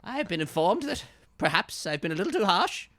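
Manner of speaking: high, loud, grudging politeness; detective voice. Acted. Detective handing over a juice box, high and grudging. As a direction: apologise stiffly like a scolded cop. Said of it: "He says".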